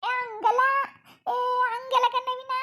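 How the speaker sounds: high, drawn-out plea down the phone; high-pitched wifely protest; pleading protest. Acted. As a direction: try to cut in, helplessly. Wife pleading with inattentive husband on phone.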